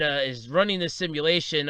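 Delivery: normal talking voice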